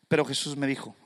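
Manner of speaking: ordinary talk, nothing marked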